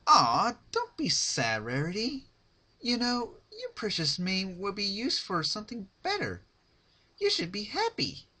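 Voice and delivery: high, loud, sugary fake sympathy; Pinkie Pie mock comfort. Pinkie consoles crying Rarity: high mock-sweet voice. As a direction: coo at her with false pity.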